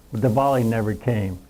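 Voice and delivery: ordinary talk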